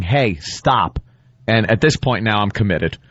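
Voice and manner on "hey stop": normal talking voice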